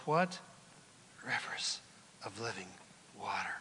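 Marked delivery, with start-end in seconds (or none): 1.2-3.6 soft unvoiced hush; whisper. Real, not acted. Preacher whispering scripture's promise to the congregation. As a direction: breathe the words out.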